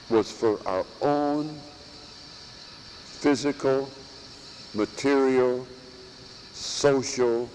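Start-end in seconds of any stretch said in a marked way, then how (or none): none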